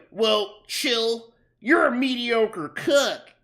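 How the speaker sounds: boss's comeback. Retold or imitated. Boss insulting his cook after being told off, mocking retort.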